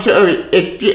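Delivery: ordinary delivery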